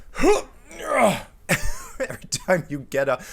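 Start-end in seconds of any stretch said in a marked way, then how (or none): 0.1-1.2 loud, high grunt of exertion; exaggerated straining grunt and groan; effortful grunt. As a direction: heave yourself up with a groan. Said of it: "you're like".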